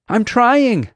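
high-pitched, loud protest; frantic high cry; panicked yell. A narrator's reading. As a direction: yell back with panic creeping in. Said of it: "she yelled".

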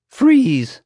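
high, loud sudden shout; yell. A narrator's reading. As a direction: yell a guard's command. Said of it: "someone yelled".

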